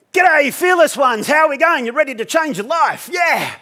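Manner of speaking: very high, loud, stretched-out cheer; hyped-up shouted greeting. Retold or imitated. Instructor greeting fifteen workshop guests, hyped-up shout. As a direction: fire up the crowd at full shout.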